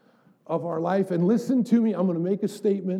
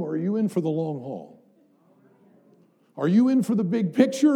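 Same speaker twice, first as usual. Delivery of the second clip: ordinary talk, nothing marked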